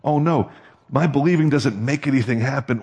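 ordinary delivery

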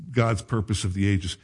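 ordinary talk, nothing marked

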